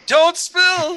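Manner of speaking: high, loud yelp of alarm; mock alarmed cry. Player acting out the beer rescue, mock alarmed cry.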